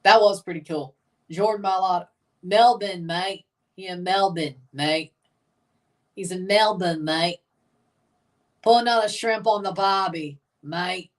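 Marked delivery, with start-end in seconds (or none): 2.4-11.1 put-on jokey amateur Australian accent. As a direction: fake Aussie accent, tossed off. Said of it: "I could do an Australian accent".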